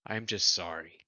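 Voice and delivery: ordinary delivery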